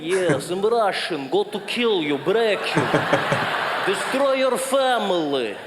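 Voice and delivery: slow, heavy Russian threat; menacing Russian accent. Acted. Comic mimics Russians sounding dangerous, menacing Russian accent. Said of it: "they've got that thing".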